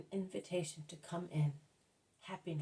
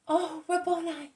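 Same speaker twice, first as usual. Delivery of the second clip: high, loud, drawn-out joyful cry; cry of loving joy; 12 semitones higher, 11 dB louder than usual. A narrator's reading. Mary runs to greet her beloved master, crying out with joy. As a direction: cry out slowly with overflowing love. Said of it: "with a cry of loving joy".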